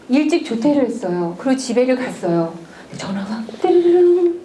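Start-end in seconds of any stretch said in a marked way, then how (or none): 3.6-4.4 phone ring imitation. Phone rings at home.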